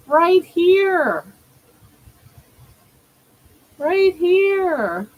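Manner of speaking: high singsong call; high, falling pet-calling tune; coaxing a pet. Real, not acted.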